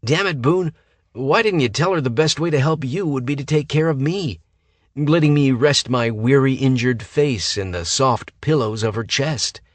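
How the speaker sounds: high, loud whiny complaint; whining. A narrator's reading. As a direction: whine like a spoiled patient. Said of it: "Hayden said with a whine".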